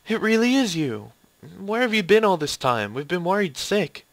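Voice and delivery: high, loud, overwrought relief; emotional relief. Acted. Student finds missing teacher after worrying, high emotional outburst. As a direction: gush with worry at a reunion.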